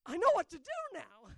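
loud and high, cocky triumph; triumphant confidence. Retold or imitated. Pastor retelling a possession, loudly sure of himself. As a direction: announce it like you finally cracked it.

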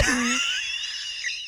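high burst of laughter. Real, not acted. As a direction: cackle at your own joke. Laughs at own joke.

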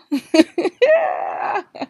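loud high laugh; real uncontrolled cackling; laughing fit. Real, not acted. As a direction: burst out laughing at your own remark. Cracks up at own remark.

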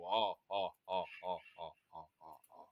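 low wizard-like evil chuckle; cartoon sorcerer's sinister laugh. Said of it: "ele dá uma risada maléfica".

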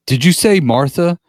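hammed-up outraged Martha line; mock shouted question. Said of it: "then Deadpool goes".

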